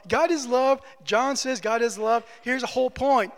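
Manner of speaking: usual speaking voice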